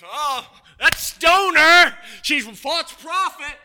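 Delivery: hammed-up indignant yell; mock outrage. Preacher mocking his own denial, loud joke shout.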